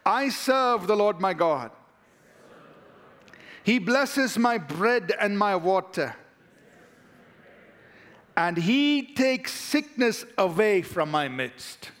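slow, measured declaration, every word laid down. Shown as a demonstration. Pastor leading the church in a slow spoken confession. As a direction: lead the congregation through it slowly. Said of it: "let's say this together".

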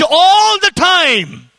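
"all" stretched long and high; stress on ALL. Acted. Preacher voicing believers' doubt to God, "all" stretched in protest. Incredulous question on God's command.

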